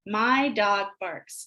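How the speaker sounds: slow, even sentence; slow model sentence. Shown as a demonstration. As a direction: model it slowly for the kids. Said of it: "You say the sentence".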